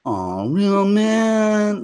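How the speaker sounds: slow, high, let-down mock sigh of a line; a hint of a hurt hero, drawn out; deflated Star-Lord. Star-Lord answering the villain, mildly deflated. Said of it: "And he's like".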